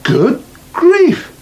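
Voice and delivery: high startled exclamation; just noticed his mismatched boots; sudden surprise. Acted. Son realising his boots don't match, startled.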